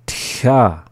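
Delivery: "Tcha" slightly drawn out through the hissed middle; slowly. Shown as a demonstration. Teacher giving word examples, "Tcha" said a touch slowly. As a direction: stretch "Tcha" a little. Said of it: "the second time slowly".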